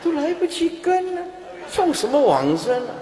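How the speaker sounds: high-pitched mock protest; comic exasperated complaint. Dying person scolding the too-fast chanters, comically exasperated. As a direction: play a dying person who can't keep up, voice jumping high.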